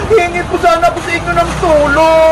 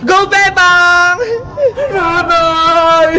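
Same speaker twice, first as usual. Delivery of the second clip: crying; much slower than usual. Acted. Hurt child cries out for her mother, crying. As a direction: bawl for mother in mock sobs. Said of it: "Bakit ka umiiyak?".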